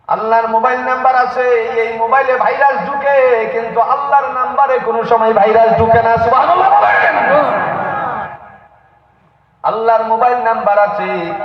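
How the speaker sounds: high, fervent sermon pitch; rousing preaching. Acted. Preacher telling the crowd Allah's number gets no virus, rousing preaching. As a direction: build to a call for praise.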